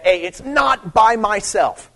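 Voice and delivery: slow, with the weight on "by myself"; slow emphatic voicing of Jesus. Shown as a demonstration. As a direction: press down on "not by myself". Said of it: "he's stressing that".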